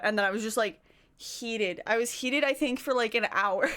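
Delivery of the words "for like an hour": ordinary delivery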